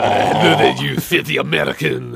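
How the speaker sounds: lightly voiced French villain; French accent.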